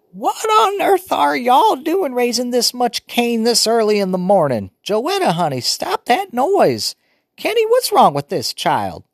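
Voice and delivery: put-on high, loud old woman fussing; fussy grandmother scolding; old grandma voice. A narrator's reading. Grandmother woken early, fussing at the noisy children.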